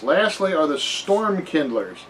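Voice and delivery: ordinary talk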